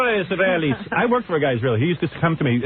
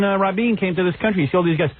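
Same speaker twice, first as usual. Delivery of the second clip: ordinary talk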